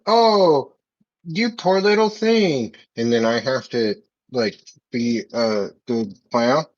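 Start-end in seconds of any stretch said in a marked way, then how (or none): 0.1-2.8 high, drawn-out cooing sympathy; mock pity. Passerby pitying a crying clown, mock pity. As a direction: coo over a poor hurt thing. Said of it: "somebody comes up and it's like".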